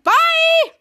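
loud, high mock farewell shout; called-out goodbye. Charlotte cheerfully leaving the others behind, called out loudly. Acted quote, calling goodbye.

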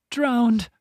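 a high, breaking cry of dismay; voice cracking. A narrator's reading. Finta finding the drowned cat, voice cracking. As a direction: let the word break in your throat. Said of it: "the woman's voice cracked".

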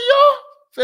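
very high, loud amazed exclamation; sudden squealed yo of surprise; shocked yo. Retold or imitated. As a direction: yelp it in disbelief. Shocked at Facebook replies.

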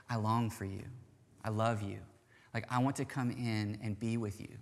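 soft, low and tender; hushed, restrained longing; gentle, patient plea. Retold or imitated. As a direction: speak softly as a respectful husband at the door. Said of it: "he is gentle, and he is patient".

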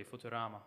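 "Futurama" with a plain T, "Foo-ter-ama"; a soft, offhand local "Futurama" without the "ch"; local pronunciation. Shown as a demonstration. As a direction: say "Futurama" softly as "Futter-ahma". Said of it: "that's how we say it here locally".

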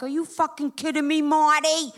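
high, indignant complaint building on the name; outraged protest. Acted. Actress protests to her agent at the callback, outraged.

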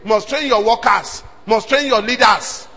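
normal talking voice

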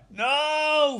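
high, drawn-out mock wail; hammed-up cry of no; mock scream. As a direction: wail it like a burning villain. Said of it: "Fred starts screaming".

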